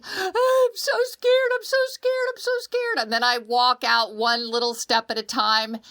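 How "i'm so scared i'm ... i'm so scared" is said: put-on scared child. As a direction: wail it like a terrified little kid. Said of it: "I climb the ladder crying".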